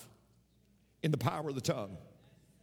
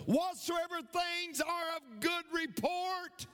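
high, drawn-out preaching cry; rousing preacher climax; 9 semitones higher, slower than usual. Real, not acted. Preacher crowning a list of scripture for the congregation, rousing cry. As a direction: peak the list, slow and high, stretching each word.

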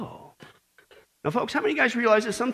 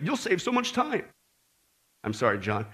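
normal talking voice

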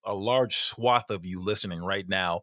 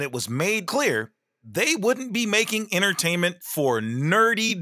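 lightly mocking read, rising higher at the end; reading a comment aloud; 8 semitones higher, 3 dB louder than usual. Co-host reading a listener comment, mildly mocking. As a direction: read it with a sly mocking lilt. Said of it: "He says".

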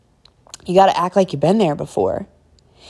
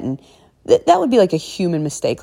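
ordinary talk

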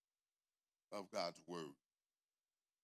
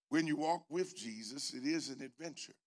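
preaching at full force; 3 semitones higher, 5 dB louder than usual. Real, not acted. Preaching climax.

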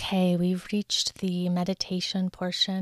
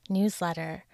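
ordinary delivery

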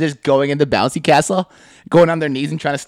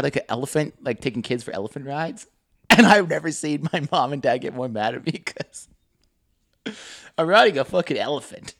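normal talking voice

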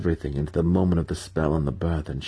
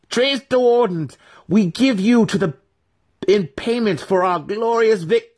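ritual invocation; 7 dB louder than usual. A narrator's reading. Brisa offering the baby in ritual, high solemn invocation. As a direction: intone to the Spider Queen.

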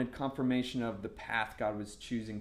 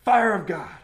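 loud, raised prophetic shout; abrupt, forceful proclamation; mock prophet; 6 semitones higher, 11 dB louder than usual. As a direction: imitate a prophet blasting out a blessing. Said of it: "And the guy was like".

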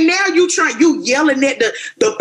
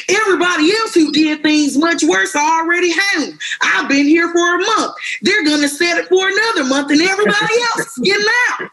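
higher, indignant mock complaint; mock courtroom yell; 7 semitones higher than usual. Inmate yelling at the judge, higher indignant mock whine. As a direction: whine it like a prisoner protesting unfairness. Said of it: "That's what he yelled out in the courtroom".

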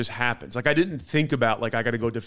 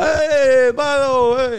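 high, loud mock shout at the car; hammed-up high yell; shouted quote; 13 semitones higher, 9 dB louder, much slower than usual. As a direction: yell it up high as the SUV passes. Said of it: "the guy goes".

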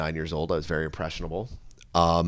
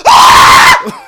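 very high, loud scream; sudden high-pitched mock cry; mock climax scream; 13 dB louder than usual. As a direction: let out a piercing comic "Ah!".

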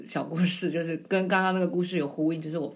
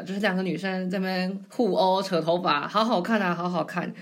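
ordinary talk, nothing marked

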